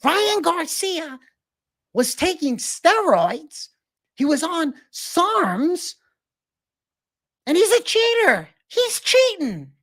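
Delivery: very high, slow and drawn out; hammed-up falsetto Doucette; Greg Doucette impression. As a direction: stretch every word way up high. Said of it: "There's your fucking Greg Doucette".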